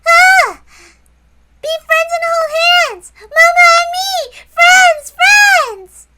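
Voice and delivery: put-on high, loud, squealing child, laughing and crying; overjoyed little girl. Little Maria hears she gets dessert, high ecstatic squeals. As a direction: shriek with joy like a tiny girl.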